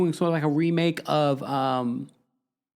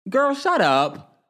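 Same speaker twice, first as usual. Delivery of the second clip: incredulous; 12 semitones higher, 4 dB louder, much slower than usual. Real, not acted. Reacting to the quote.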